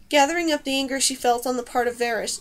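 usual speaking voice

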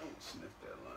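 unvoiced, soft whisper. Real, not acted. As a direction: whisper it playfully. Whispered joke again.

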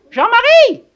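loud high call to the neighbour. Acted. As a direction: call across the garden. Calling out to neighbour. (interpeller Jean-Marie de loin)

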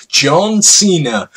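slow, loud, high wrestling intro; hammed-up wrestling announcement; ring announcer. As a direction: drag the name out like a ring announcer.

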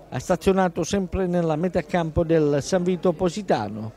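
normal talking voice

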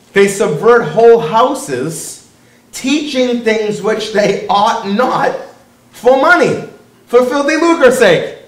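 slow, drawn-out declamation; emphatic scripture reading. Real, not acted. Preacher quoting scripture to the congregation, slow and forceful.

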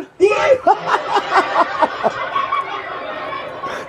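cracking up at the roast, wheezing; breathy high laugh. Real, not acted.

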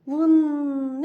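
loud blown wind noise; wind whoosh. Acted. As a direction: blow it like a gust. Wind blows again.

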